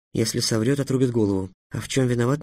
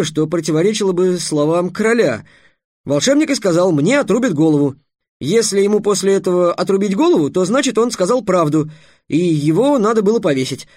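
high, raised, eager reasoning; pitched-up voice, louder as the logic builds; excited explanation; 7 semitones higher, 5 dB louder than usual. A narrator's reading. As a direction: argue it out with mounting excitement. Trix explains his riddle excitedly.

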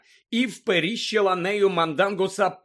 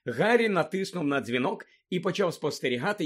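ordinary delivery

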